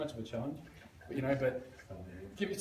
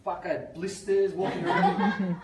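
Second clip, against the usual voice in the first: laughing complaint; 3 semitones higher, 9 dB louder, slower than usual. Retold or imitated. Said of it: "she's like".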